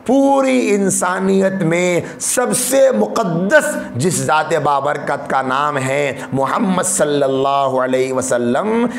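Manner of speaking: usual speaking voice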